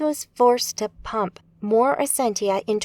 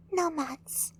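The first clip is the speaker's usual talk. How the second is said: high, tense whisper; 6 semitones higher, 9 dB quieter than usual. A narrator's reading. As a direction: whisper the warning. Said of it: "she whispered to Maria".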